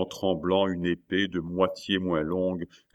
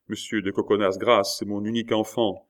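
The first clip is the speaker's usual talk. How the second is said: usual speaking voice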